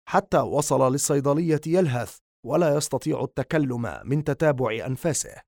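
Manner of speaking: usual speaking voice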